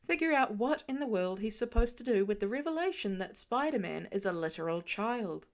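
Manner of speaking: ordinary delivery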